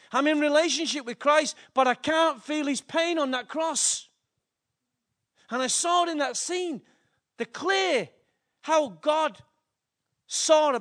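fervent preacher driving the point home; preaching at full force. Real, not acted. Preaching builds, louder, higher.

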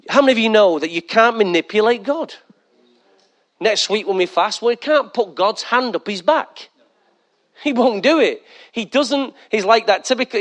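ordinary delivery

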